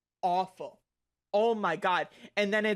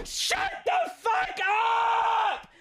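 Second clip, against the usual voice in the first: long drawn-out scream, much higher; furious shout; 3 dB louder, much slower than usual. Real, not acted. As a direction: scream it slow and stretched in rage. Ranting at spam message.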